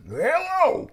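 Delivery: very high, loud put-on hello; lawnmower guy voice. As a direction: squeal hello in the silly accent. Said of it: "It was my lawnmower, man".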